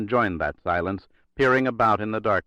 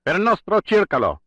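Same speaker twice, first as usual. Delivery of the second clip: high, loud fervent scream; 10 semitones higher, 6 dB louder than usual. Acted. Telenyakov screams the Corsican phrase during the ambush, loud high scream. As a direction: scream the phrase like a battle cry. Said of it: "as was the enigmatic phrase that followed".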